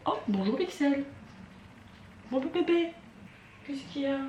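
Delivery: high, sweet voice for a pet. Real, not acted. Greeting her pet.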